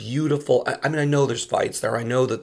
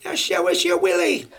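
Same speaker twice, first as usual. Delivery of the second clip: high mock child yell; little girl yelling; 7 semitones higher than usual. Imitating a six-year-old heckler yelling from the front. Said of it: "She yells".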